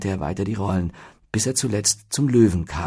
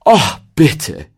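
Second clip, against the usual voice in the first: loud, high, eager interruption; bubbling over with eagerness; excited; 6 semitones higher, 12 dB louder than usual. A narrator's reading. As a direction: burst in begging. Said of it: "fiel ihm Zettel aufgeregt ins Wort".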